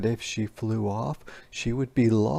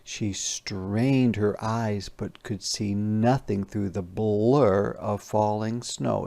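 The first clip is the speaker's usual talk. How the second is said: plain reading voice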